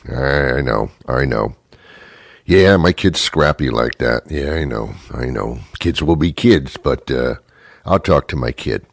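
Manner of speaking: low, resigned parent brushing it off; mildly placating parent; casual concession. Acted. Parent casually concedes kid's bad behavior.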